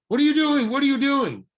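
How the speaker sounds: loud, high angry shout. Retold or imitated. Director shouting at the casting agent, loud and high. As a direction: yell at her to stop. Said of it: "He's shouting at her".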